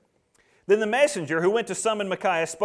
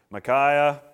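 reluctant asking; 7 semitones lower than usual. Acted. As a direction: ask as if you'd rather not. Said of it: "picture Ahab not wanting to ask".

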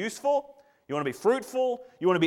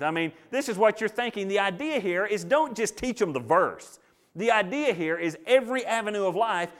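ordinary delivery

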